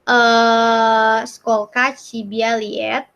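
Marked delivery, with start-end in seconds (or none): 1.3-3.1 "skolka Cibialiet" said slowly, syllable by syllable; careful teacher demo; slow Russian demo. Shown as a demonstration.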